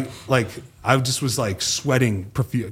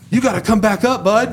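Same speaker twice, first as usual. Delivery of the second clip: panicked partner; 10 semitones higher, 5 dB louder than usual. Retold or imitated. Retold partner freaking out.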